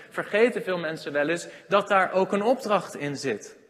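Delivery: usual speaking voice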